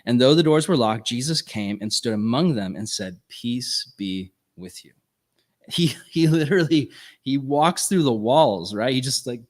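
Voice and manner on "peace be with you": lower, soft and slow peace; gentle blessing. Acted. Jesus greeting frightened disciples, soft and slow. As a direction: hush it like a calm blessing.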